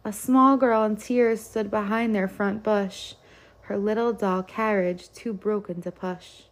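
usual speaking voice

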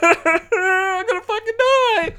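put-on very high, slow baby voice; toddler voice. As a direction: squeak it out like a toddler. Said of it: "is just looking at it going".

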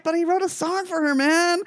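high-pitched, exasperated objection; playful whiny protest; mock protest. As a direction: plead the case in exaggerated disbelief. Speaker acts out own protest.

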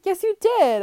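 indignant retort. Retold or imitated. Retold argument, insisting he kicked her out.

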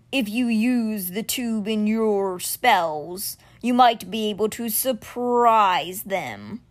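very slow, grave creature voice; measured like a great stone beast; stone cat Simber. Acted. Stone guardian bringing news to Alex, slow and grave.

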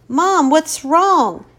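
high, slow worried question; alarmed. Acted. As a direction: ask in sudden alarm. Daughter sees mother's horror.

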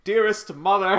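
high, loud, theatrical salutation; mock old-fashioned letter-writer. Joking about kids journaling to mother, mock old-fashioned. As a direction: declaim it like a hammed-up period letter.

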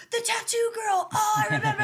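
high, loud sudden recognition; excited recognition. Retold or imitated. Rock star remembering the tattoo girl, loud and high. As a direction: shout it as the memory clicks.

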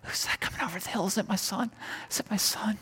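breathy near-whisper, pitch lifted, wondering who comes over the hill; hushed father's wonder. Retold or imitated. Preacher voicing the father spotting his son far off, in a hushed whisper. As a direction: hush it like a father straining to see his distant son. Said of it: "He's like".

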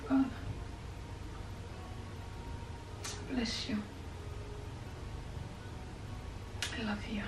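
soft, whispered prayer to God; trailing into whispered thanks; hushed prayer. Real, not acted. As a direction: pray quietly, close and intimate.

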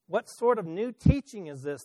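mildly excited crowd voice; excited question. A narrator's reading. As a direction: ask it with some excitement. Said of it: "they asked excitedly".

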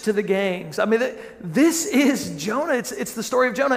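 emphatic, pitch swooping up and down on key words; excited realization. Retold or imitated. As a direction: land it like a big discovery. Retells his excited realization to Melanie.

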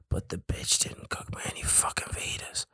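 hushed whispered aside; whispering. Real, not acted. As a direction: whisper it like a cheeky dig. Griping aside about sister.

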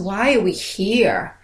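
usual speaking voice

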